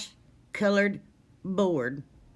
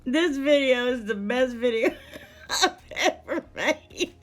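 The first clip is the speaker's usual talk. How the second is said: laughing; 4 semitones higher than usual. Real, not acted. Laughing to tears.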